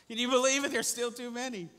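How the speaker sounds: high, loud disbelief; worked-up preacher, astonished and loud; incredulous. Real, not acted. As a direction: marvel at it at the top of the voice. Preacher marvels at God's demand.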